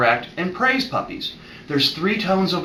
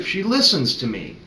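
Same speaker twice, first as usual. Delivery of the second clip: ordinary delivery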